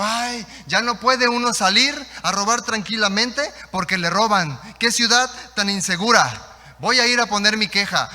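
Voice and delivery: loud, high mock lament; retold shouted complaint. Robber complains that the city is unsafe, mock shouted complaint. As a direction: cry out as the wronged victim. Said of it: "Y gritó y dijo".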